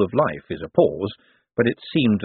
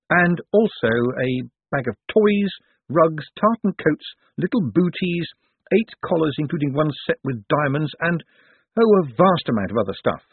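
Angua listing the items; 7 semitones higher than usual. Acted. Angua lists the dog's belongings.

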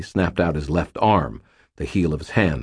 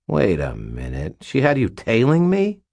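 dawning indignant disbelief; 6 semitones higher than usual. A narrator's reading. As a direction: realise it, then rise in disbelief. Butters realises he has been followed.

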